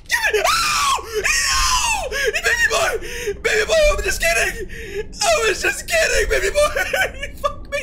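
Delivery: very high, loud panicked cries; terrified screaming. Real, not acted. Gamer caught by the monster, screaming and laughing in terror. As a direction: shriek in terror, half laughing.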